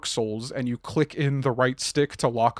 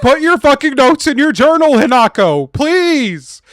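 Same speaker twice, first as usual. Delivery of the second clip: high-pitched, very loud begging yell; exasperated shouting; 13 semitones higher, 13 dB louder than usual. Pretend. Pleading with Hinako over her journal, exasperated shouting. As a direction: shout it as a hammed-up desperate plea. Yelling at game character about notes.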